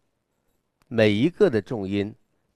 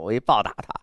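ordinary talk

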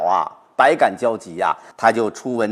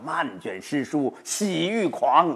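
slow joyful recitation; 4 semitones higher, 4 dB quieter, slower than usual. Shown as a demonstration. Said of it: "你就想象你整理这些诗书啊".

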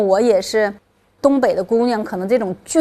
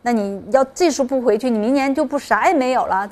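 higher-pitched imitation of mom pressing her to go back; retold motherly nagging, voice raised in pitch; urging mother; 4 semitones higher, 4 dB louder than usual. Mother tells athlete daughter to rejoin the team, urging.

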